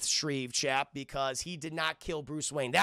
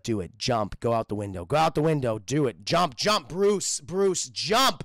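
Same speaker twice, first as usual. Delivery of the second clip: low, loud sinister goading; evil emperor urging, building in force; Palpatine; 4 semitones lower, 7 dB louder, slower than usual. Retold or imitated. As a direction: chant the orders in a villain voice. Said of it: "It's Papa Palpatine".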